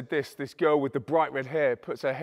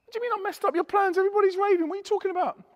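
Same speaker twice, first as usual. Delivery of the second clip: very high, incredulous squeal; high-pitched acted disbelief; baffled protest; faster than usual. His baffled reply to the accusation.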